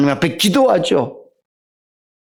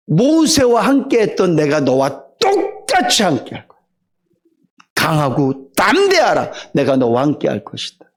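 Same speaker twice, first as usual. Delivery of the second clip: reassuring God; 4 semitones lower than usual. Acted. God strengthening Joshua.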